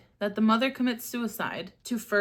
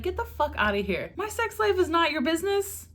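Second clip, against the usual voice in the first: indignant retort; 9 semitones higher than usual. Pretend. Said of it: "I'd be like".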